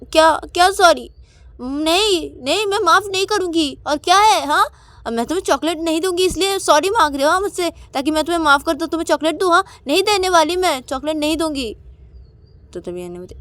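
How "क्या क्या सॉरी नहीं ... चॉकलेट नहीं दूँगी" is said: high, loud indignant rejection; angry refusal. Acted. Payal refuses to forgive Ananya, high indignant refusal. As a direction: throw the apology back, loud and childish.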